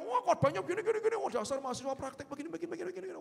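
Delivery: high, loud, fast nagging complaint; mock grumbling. Caller complaining on the phone about the trainee preacher, mock grumbling. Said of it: "dia ngomel".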